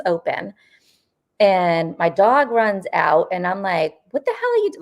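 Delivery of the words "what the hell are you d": high-pitched mock yell cut off; imitating yelling at the aunt; retold yell. Yelling at her aunt on the stairs, high mock yell. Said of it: "I'm yelling at her".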